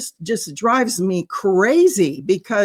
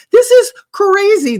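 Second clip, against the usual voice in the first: much higher and louder, incredulous; exasperated outburst; 12 semitones higher, 8 dB louder than usual. Real, not acted. Commentator fed up with city leaders, exasperated outburst. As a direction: burst out in exasperation. Ranting about officials' priorities.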